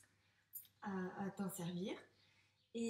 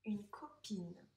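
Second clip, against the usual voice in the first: slow, unvoiced whisper of une copine; much slower than usual. Shown as a demonstration. Teacher whispering the new word slowly to the pupil. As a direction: breathe the word out slowly in a hush.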